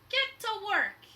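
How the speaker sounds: loud, high barked command; captain ordering the diggers; pirate order. Acted. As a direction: bark it at the crew. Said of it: "he said to his workers".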